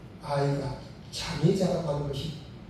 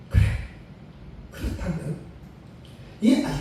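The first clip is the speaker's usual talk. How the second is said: resolute decision; 8 semitones higher, 3 dB louder than usual. Retold or imitated. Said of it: "하고 마음에 결단을 한 거예요".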